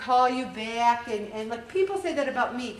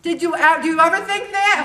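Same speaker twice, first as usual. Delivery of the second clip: higher and louder, pressing the group; emphatic loud challenge; 6 semitones higher, 10 dB louder than usual. Real, not acted. Leader challenging the meeting group: loud, emphatic question. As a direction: throw the question back at them hard.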